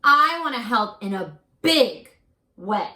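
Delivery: expressive read-aloud, slowing on key words; reading to children. A narrator's reading. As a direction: tell the hopeful part to the class. Teacher reads picture book aloud.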